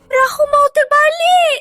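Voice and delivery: crying out that the boat tips; fearful wail; frightened cry. Acted. Boat about to capsize.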